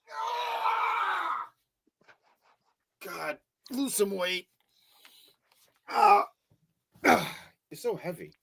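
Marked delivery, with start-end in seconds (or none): 0.1-1.5 sudden high strained yelp; startled high shriek of effort; effort cry. Real, not acted. Lifting the heavy cat. 5.9-6.3 loud high strained yelp; loud high straining shout; effort cry. Real, not acted. Lifting the heavy cat.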